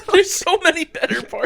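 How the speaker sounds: high, loud, through laughter; cracking up mid-sentence; laughing. Real, not acted. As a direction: laugh while protesting. Breaks up over the retold film.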